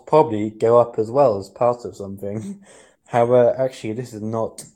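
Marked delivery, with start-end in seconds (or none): none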